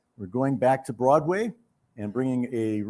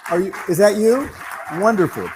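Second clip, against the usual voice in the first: loud, higher call to the back of the hall; 6 semitones higher, 6 dB louder than usual. Real, not acted. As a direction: shout it out to the winner. Spotting the winner in the crowd.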